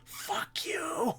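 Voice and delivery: put-on whispery, squeezed-out baby voice; hushed imitation of a cursing baby; voiced baby. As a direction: play the baby in a strained whisper. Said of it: "The baby's just like".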